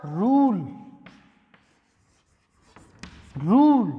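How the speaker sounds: रूल with a long drawn u, slowly; model pronunciation. Shown as a demonstration. Teacher to students, slow demo of the long u. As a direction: stretch the u in रूल. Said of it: "यानी ऊ की बड़ी sound आ रही है".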